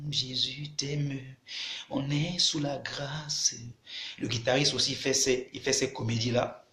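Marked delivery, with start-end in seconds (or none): 0.1-3.5 slow, hushed, syrupy mock of a soft preacher; a hushed, slowed parody of easy grace; mock sugary worship voice. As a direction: croon it like a cheap worship leader. Mocking soft worship talk.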